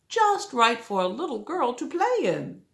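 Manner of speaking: usual speaking voice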